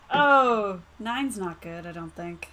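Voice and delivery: ordinary talk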